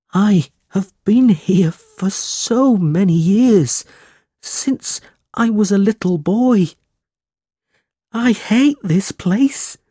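high, slow, wailing despair; a boy crying his heart out, high and slow. A narrator's reading. Smike pouring out his misery to Nicholas, high tearful wail. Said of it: "cried Smike".

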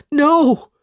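high, loud cry of refusal. A narrator's reading. Smike refusing to go home, crying out. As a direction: cry out like a frightened boy. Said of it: "cried Smike".

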